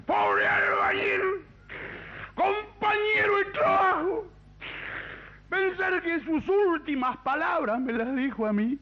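put-on slow, high-pitched drunken wail; hammed-up lament, long drawn-out cries; drunk mourner. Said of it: "porque dijo el borracho". (lamento de borracho, agudo y arrastrado)

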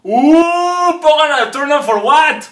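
high, loud party shout; party hype. Acted. Party whoop calling for the song, loud and high. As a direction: hype the crowd like a club.

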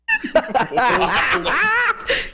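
high wheezing laughter; cracking up helplessly; hysterical laugh. Real, not acted. Laughing at retold reaction.

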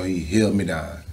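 normal talking voice

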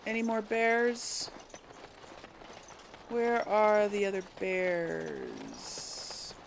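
ordinary talk

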